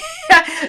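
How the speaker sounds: a quick high burst of laughter; short laugh. Real, not acted. Laughs while reading comments.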